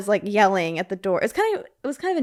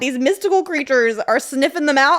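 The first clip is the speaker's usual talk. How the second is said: mock-crying oh my God, then higher and higher in outrage; exasperated disbelief; 6 semitones higher, 4 dB louder than usual. Host reacting to the movie, exasperated mock-cry rising to high outrage. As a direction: whine it at the screen in disbelief. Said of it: "And I'm like".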